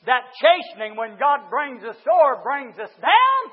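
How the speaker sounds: forceful, drawn-out preaching. Real, not acted. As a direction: drive it home like a revival preacher.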